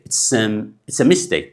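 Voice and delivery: slow, with "a" softened to a quick schwa; reduced a. Shown as a demonstration. Teacher showing learners how "a" shrinks in speech. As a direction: let the "a" in "mistake" sentence melt into a light uh. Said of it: "ናብ ኣዝዩ ሃሳስ ዝኾነ".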